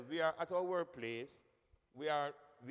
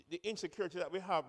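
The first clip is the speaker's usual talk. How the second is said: normal talking voice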